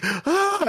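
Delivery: anguished yell. Acted. NPC screams in rage.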